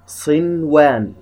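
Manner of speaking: صنوان said slowly with a distinct N; clear unmerged nun. Shown as a demonstration. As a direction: sound the N fully before the waw in صنوان. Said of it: "نظهرها إظهار مطلق". (إظهار النون بوضوح في صنوان)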